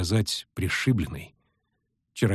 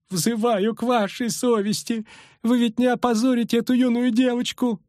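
whining matron; 11 semitones higher than usual. A narrator's reading. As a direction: whine it like a frightened chaperone. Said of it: "заныла Матрона".

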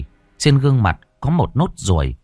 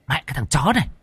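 scolding woman; 10 semitones higher, faster than usual. A narrator's reading. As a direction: tear into the boy, shrill and quick. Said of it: "Giọng người đàn bà đay nghiến".

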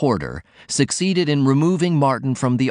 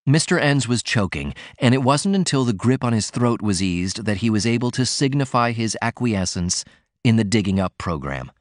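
ordinary delivery

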